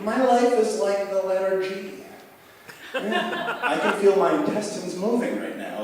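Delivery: high, spaced-out stoner voice with a laugh; stoned rambling. Retold or imitated. Edible user describing the high, spaced out and giggly. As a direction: drift off like someone far too high. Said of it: "you're like".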